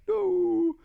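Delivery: high whimpered wail; comic crying voice; mock tearful. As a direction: whimper it like a sobbing child. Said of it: "I was crying".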